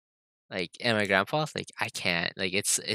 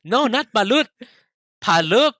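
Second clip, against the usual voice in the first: imitating mom, higher and insistent; mock-insistent mom setting it straight; emphatic correction; 8 semitones higher, 5 dB louder than usual. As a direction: correct them firmly in a raised pitch. Mom correcting his wrong guess.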